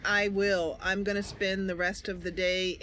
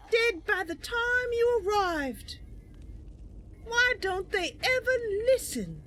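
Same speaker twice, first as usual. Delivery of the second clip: much higher, slow, pointed repeat of the threat; repeated taunt, slower; 13 semitones higher than usual. Acted. Mercy repeating her threat to stubborn enemies, high and slow. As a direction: spell it out again to a foe who won't listen.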